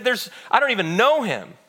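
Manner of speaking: high-pitched, loud protest; emphatic denial. A narrator's reading. Peter denying to the servant girl that he knows Jesus, agitated. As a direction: deny it with rising agitation. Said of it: "Peter denied it".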